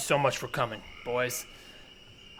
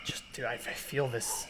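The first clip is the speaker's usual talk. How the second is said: breathless whisper, barely voiced; whispering to the friend in awe of her new power, breathless; 10 dB quieter than usual. Overwhelmed by primal feeling.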